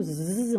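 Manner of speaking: z held as a long buzz; a sustained zzz sound. Shown as a demonstration. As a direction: let z hum on its own, stretched. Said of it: "on entend bien z".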